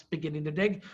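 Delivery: ordinary delivery, nothing marked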